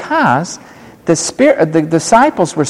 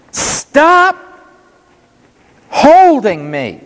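voiced Jesus; 11 semitones higher, much slower than usual. Jesus speaks to Mary.

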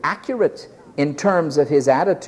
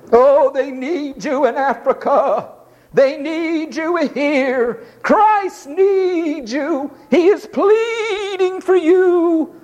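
higher, very slow, syrupy mock appeal; pleading recruiter; 10 semitones higher, slower than usual. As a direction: lay the guilt trip on thick. Parodying mission recruiters.

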